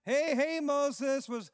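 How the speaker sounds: high mocking sneer at Moses; sarcastic Israelites. Israelites mocking Moses in the desert, sarcastic. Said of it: "This, this is sarcasm here".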